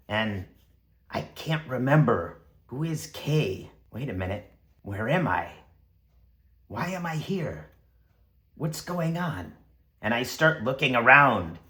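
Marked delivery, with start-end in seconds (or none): none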